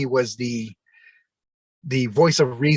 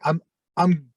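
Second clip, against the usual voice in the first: usual speaking voice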